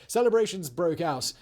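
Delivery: normal talking voice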